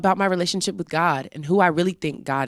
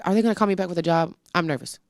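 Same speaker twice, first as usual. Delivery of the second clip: nervous rush; much faster than usual. Retold or imitated. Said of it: "Or I'm like".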